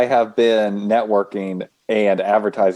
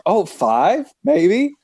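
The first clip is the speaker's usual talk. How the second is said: higher, self-mocking shrug of a reply; sheepish admission; 7 semitones higher, slower than usual. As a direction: own up to tiny numbers with a wince. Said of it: "I was like".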